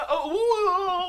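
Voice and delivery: echo joke. Pretend. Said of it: "I was making the echo joke".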